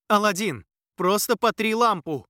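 loud high impatient order; urgent shout. Acted. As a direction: cut him off, shout it. Urgent interruption in chase.

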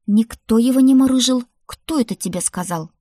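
loud, high protest, easing on the question; indignant denial. A narrator's reading. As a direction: round on the accuser. Grandmother indignantly confronts the brother. (возмущённо, грозно)

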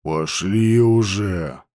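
low, slow, fed up; impatient low voice. Acted. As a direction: drag it out impatiently. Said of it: "Ауранг закатил глаза".

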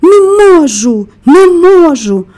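high, loud, drawn-out protest; distressed wail. A narrator's reading. Sobbing Colin insisting he cannot stop, wailing high and slow. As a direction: wail it slowly, in despair.

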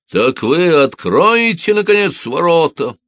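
the gang leader loses patience; thunderous roar. A narrator's reading. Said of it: "громовым голосом прорычал главарь".

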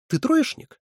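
high, leaping question; incredulous question. A narrator's reading. Someone questions the boy about his grades, incredulous. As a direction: ask it sharply with pitch shooting up. Sharp question in acted dialogue.

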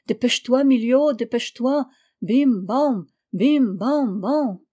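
urgent bell chime. A narrator's reading. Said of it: "semblait l'appeler, le presser d'accourir".

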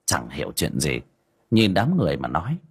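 usual speaking voice

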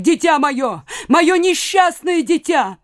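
high, drawn-out lament; grieving wail. A narrator's reading. Mother mourns her doomed child, wailing. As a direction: wail high, slowing in grief. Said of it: "вопль старухи-матери, проникнутой такой глубокой скорбью".

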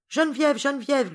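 high, loud call of her name. Acted. Georges calling to Geneviève after running off, crying out. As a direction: cry out her name, pitched well up. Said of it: "lui cria-t-il".

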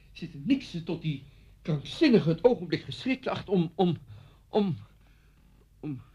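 outburst stalling into halting repeats; exasperated faltering. Acted. Host sick of waiting for the madman, exasperated faltering. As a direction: run out of words, pausing longer, then give up.

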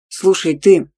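loud, displeased warning; forceful, irritated address; loud displeasure. A narrator's reading. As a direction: cut in loudly, annoyed. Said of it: "громко сказал он так".

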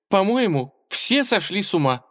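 high, plaintive whine; complaining. A narrator's reading. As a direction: whine it helplessly. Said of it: "пожаловалась она".

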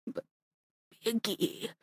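father gasping last orders to daughter; dying whisper. Acted. (тихо, шёпотом, умирая)

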